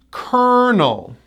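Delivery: "Colonel" said as KER-nul, high then falling; slow "Colonel" down, stressed KER-nul; model pronunciation. Shown as a demonstration. Teacher repeats the word for learners, slow "Colonel" as KER-nul. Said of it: "We pronounce this word as".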